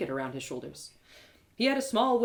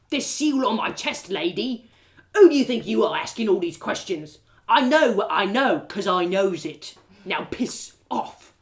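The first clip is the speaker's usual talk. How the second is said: angry guard; 7 dB louder than usual. Acted. Guard pulls rank on the questioning lady, bullying. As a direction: bark at her like a bully guard.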